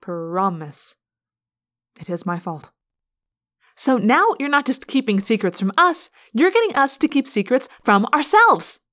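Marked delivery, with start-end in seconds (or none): 3.7-8.8 higher, louder accusation; angry yell. A narrator's reading. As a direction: raise your voice, indignant. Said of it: "Rachel yelled".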